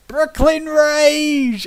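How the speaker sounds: high, drawn-out mock war shout; Brooklyn battle cry. Player's Vulcan kicks a door down, loud drawn-out Brooklyn yell. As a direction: bellow it like a raging Brooklyn brawler. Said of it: "his Brooklyn accent".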